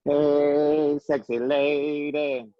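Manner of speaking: drawn-out, held-note greeting; playful catcall. Pretend. Host greets the co-host on air, drawn-out playful catcall. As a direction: stretch it out like a cheesy catcall.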